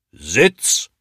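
sharp command, swooping up; shrill policeman's snap. A narrator's reading. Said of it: "schnauzt er mit seiner schrillen Polizistenstimme".

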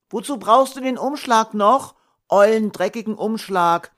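put-on a little boy sulking back at his father; boy dismissing the dirty envelope; defiant child. A narrator's reading.

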